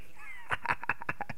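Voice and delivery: high whispered evil cackle; evil laugh. Acted. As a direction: cackle like a sly villain. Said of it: "A risada maléfica".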